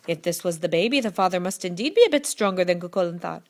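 plain reading voice

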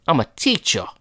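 laughing Cockney teacher voice; mock Cockney teacher. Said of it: "That's Cockney".

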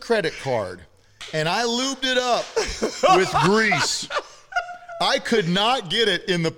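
laughing loudly. Real, not acted. Teasing his buddy about tight pants, loud laughing voice.

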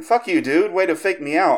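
retold angry yell. Friend over Discord cussing him out, mock angry yell. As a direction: imitate a gamer raging at being faked out.

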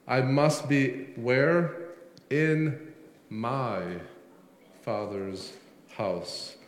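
slow, each word set apart after where, my Father's falling off quietly; stress on my Father's. Shown as a demonstration. Preacher spells out Jesus' answer, pausing on each word. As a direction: lay weight on "my Father's" as its own phrase. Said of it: "There's a subtle emphasis difference there".

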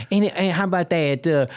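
ordinary talk

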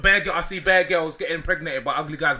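ordinary delivery

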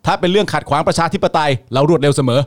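mock official statement, loud and assured; ล้อแถลงการณ์. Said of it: "ผมจะมาแถลงการให้สลิมฟัง". (แกล้งแถลงการณ์เสียงดังฟังชัด)

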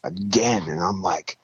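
ordinary delivery

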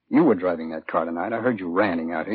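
ordinary talk, nothing marked

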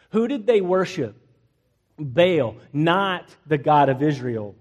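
normal talking voice

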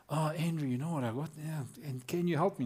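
understated acted plea; imitated hesitant request. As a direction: imitate a churchgoer quietly seeking help. Acts churchgoer asking him for help.

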